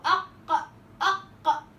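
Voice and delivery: أق with a strong closed catch, then a forceful voiced release; explosive qaf. Shown as a demonstration. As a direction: say أق with a hard stop and a burst. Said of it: "وأنا بفك بقوة".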